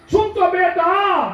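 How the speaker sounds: loud, very high, long-drawn shout; calling out. Acted. Father running after his son by the pond, shouting out. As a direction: holler it slowly across a distance.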